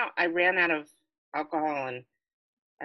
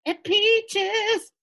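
excited; 4 dB louder than usual. Acted. Party cheer.

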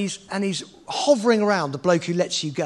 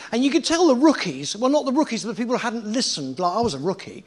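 ordinary delivery

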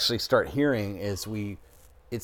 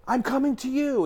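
high, sustained imitation of mom calling out; mom yelling; 14 semitones higher than usual. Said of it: "And she's yelling".

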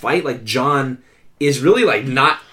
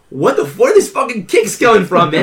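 higher, louder acted disbelief; mock astonishment; 5 semitones higher, 4 dB louder than usual. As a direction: react as if amazed by the kicks. Acting out astonished fight reaction.

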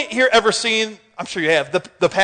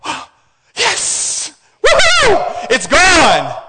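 delighted mock cheer, a high woohoo; joyful relief; 7 semitones higher, much slower than usual. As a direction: celebrate the storm vanishing. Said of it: "And then you're like".

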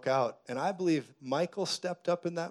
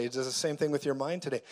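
ordinary delivery

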